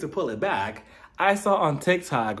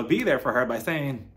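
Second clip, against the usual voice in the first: normal talking voice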